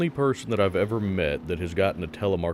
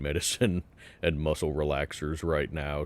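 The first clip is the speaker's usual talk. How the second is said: ordinary delivery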